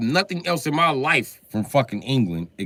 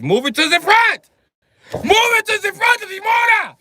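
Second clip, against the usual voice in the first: screaming much higher and louder; furious shout; 11 dB louder than usual. Real, not acted. Demanding manufacturers move the timing chain, shouting. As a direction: bellow the fix at them. Climax of engine rant.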